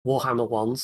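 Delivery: ordinary talk, nothing marked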